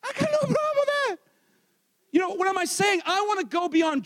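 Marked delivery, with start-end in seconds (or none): 0.0-1.2 much higher, drawn-tight voice; high-pitched insistence. Pretend. Preacher joking about bringing flowers home, high-pitched insistence. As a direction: say it in a raised, squeezed pitch. Repeats line in high mock voice.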